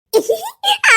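short giggle, pitched high and falling. Acted. Mocking a friend's nonsense, high giggle. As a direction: let out a high, silly giggle. Laughing at the other's foolishness.

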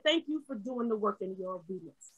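soft, low quoted voice; hushed imitation of God speaking; quiet divine reply. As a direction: voice God's answer gently and low. Retells God's gentle answer to prayer.

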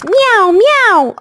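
high, sing-song meowing like a cat. Retold or imitated. Teaching kids what a cat says, high playful meows. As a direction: meow up high like a happy kitten. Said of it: "My cat says".

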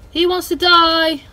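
loud slow taunt. Acted. Gamer taunting an enemy he is attacking. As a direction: relish the fight.

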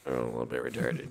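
role. Pretend. Self-mocking reply, voice change.

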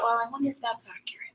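quick whisper to herself; murmured inner thought, very soft and fast. Retold or imitated. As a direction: wonder it aloud in a hushed, hurried aside. Her private thought, quoted.

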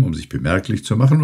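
plain reading voice

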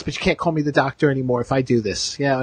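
ordinary talk, nothing marked

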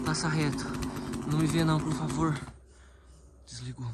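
soft, tense hiding voice; hushed hiding. Real, not acted. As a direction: keep it low so he won't hear. Hiding from killer.

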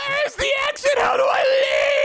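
panicked cry. Acted. Cam trapped with the dragon.

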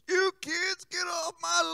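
put-on high, cracked old-man yell at kids; grumpy old man shout. Shown as a demonstration. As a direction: shout like a cranky old neighbor. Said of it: "Old Man Jenkins, we're gonna say".

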